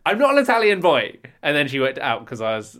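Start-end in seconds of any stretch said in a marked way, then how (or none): 0.0-1.2 raised, high-pitched mock shout of protest; indignant acted shout; retold shout. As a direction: call it out loudly, re-enacting the denial. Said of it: "an English guy shouting in English".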